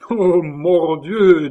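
high, loud, slow laughing oath; bursting out laughing. A narrator's reading. Caylus laughing at blue Schomberg, loud laughing oath. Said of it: "en éclatant de rire".

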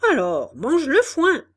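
loud, harsh order to eat; angry shout. Acted. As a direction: bark the order at the donkey. Director shouts at donkey.

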